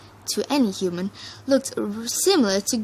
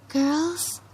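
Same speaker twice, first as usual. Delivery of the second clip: timid again; 6 semitones higher than usual. A narrator's reading. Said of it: "the Pegasus tried again".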